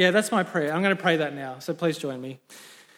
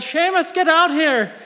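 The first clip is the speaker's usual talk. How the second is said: high, loud mock farmer's yell; calling out; 10 semitones higher, 7 dB louder than usual. Dismayed Paddy calls Seamus to the yard, hammed-up shout. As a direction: yell for the neighbour to come out. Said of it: "And he called out".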